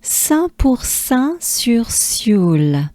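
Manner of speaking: "Saint pour Saint sur Sioule" slowly in French; measured French, each word clear for learners; French place-name demo. Shown as a demonstration. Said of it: "they will arrive in".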